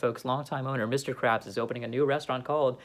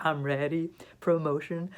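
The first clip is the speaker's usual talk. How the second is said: ordinary talk, nothing marked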